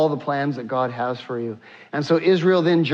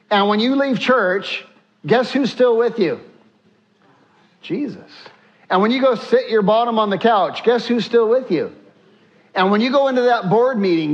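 high, driving preacher's refrain; rousing preaching; 5 semitones higher than usual. Acted. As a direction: call out each question to the church. Preacher building to a climax.

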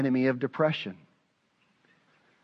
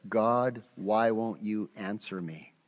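mild crying out; 5 semitones lower, 7 dB quieter, slower than usual. Retold or imitated. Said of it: "he's really crying this".

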